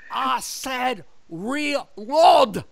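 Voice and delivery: put-on very slow, high, accented director's scream; screamed command. Director who ran up the hill, imitated scream. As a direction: imitate a director bellowing, stretching each word. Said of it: "and he screams at me".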